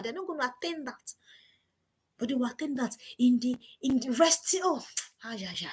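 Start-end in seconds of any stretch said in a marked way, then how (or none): none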